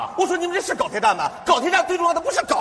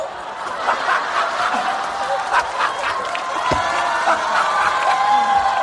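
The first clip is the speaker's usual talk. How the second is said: long shrieking laugh. Acted. Big stage laugh.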